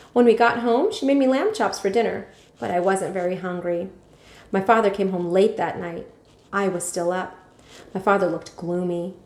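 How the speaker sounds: ordinary delivery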